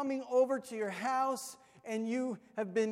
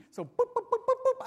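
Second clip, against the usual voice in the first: high quick boop noises for footsteps; 5 dB louder than usual. Retold or imitated. Pastor mimics himself as a kid running upstairs, high boops. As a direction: bounce up the stairs with high boops.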